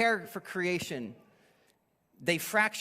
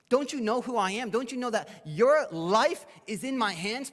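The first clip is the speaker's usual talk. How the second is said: higher-pitched, indignant challenge; acted outburst of authority; indignant demand; 6 semitones higher, 4 dB louder than usual. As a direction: pull rank on a silent prisoner. Acting Pilate asserting his power.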